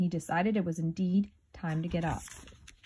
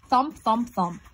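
heavy, punchy thumps, the first ones louder; thumping sound; 4 semitones higher, 5 dB louder, slower than usual. A narrator's reading. Reader acts out noise at the burrow, thumping sound. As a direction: thud the words out one by one.

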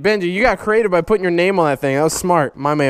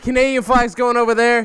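ordinary delivery

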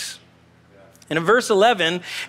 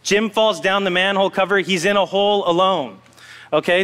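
ordinary talk

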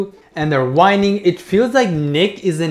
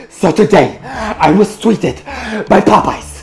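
Nick impression; 3 semitones higher, 7 dB louder than usual. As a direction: ham up a whiny loud vlogger. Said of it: "What in the actual fuck is this content?".

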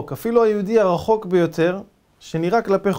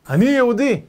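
loud, high proclamation; proud declaration; 7 semitones higher, 6 dB louder than usual. Retold or imitated. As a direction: announce it with pride.

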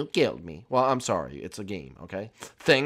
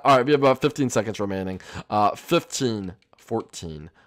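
ordinary talk, nothing marked

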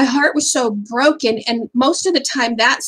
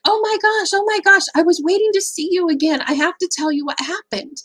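much higher, rushing to tell the news; breathless excitement; 8 semitones higher than usual. Retold or imitated. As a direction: gush like she can't hold the story in. Barista eager to report back.

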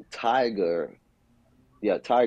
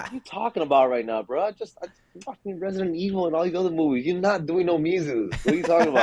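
higher-pitched, mock-incredulous challenge; retold incredulity; 7 semitones higher, faster than usual. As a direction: act out calling a friend's bluff. Acting out incredulous reply to friend.

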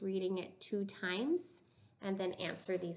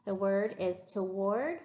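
ordinary talk

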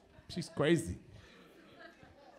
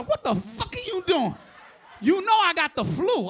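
a touch higher, annoyed wife; wife's mock outrage; 7 semitones higher, 6 dB louder than usual. Wife to husband, a mock annoyed excuse. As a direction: sound put out, like the wife. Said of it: "She said".

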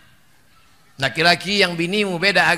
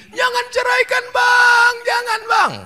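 high, pleading wife's cry; begging wife; 4 dB louder than usual. Acted. Wife begging her husband not to divorce her, high pleading. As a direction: beg him not to leave, in a high voice.

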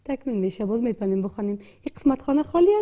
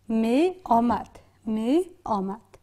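slow reading aloud; 2 semitones lower, 3 dB quieter, much slower than usual. Shown as a demonstration. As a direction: read the word slowly, syllable by syllable. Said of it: "کلمه چی شد؟".

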